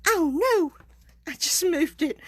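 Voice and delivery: sudden high, loud "oh no"; dismayed surprise. Real, not acted. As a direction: caught off guard as the stamp slips. Stamp slipped while crafting.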